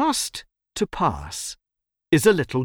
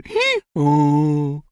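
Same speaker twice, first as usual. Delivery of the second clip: drawn-out hee-haw; donkey bray; 2 semitones higher than usual. A narrator's reading. Donkey ends its verdict on humans, braying.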